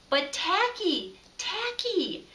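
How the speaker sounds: panicked call to wake a friend; frantic penguins crying out. A narrator's reading. The penguins begging Tacky to wake up, high and frantic. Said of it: "they cried".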